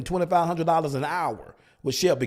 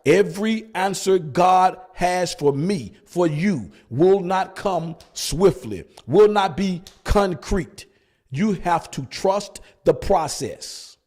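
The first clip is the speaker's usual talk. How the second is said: slow down so they can write each word; slow emphatic; 2 semitones higher, slower than usual. Acted. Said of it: "Write that down".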